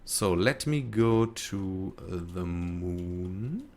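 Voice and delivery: normal talking voice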